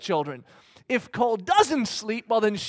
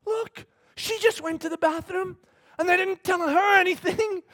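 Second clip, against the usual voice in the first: a hint of cheery reassurance; 10 semitones higher than usual. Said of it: "And I said".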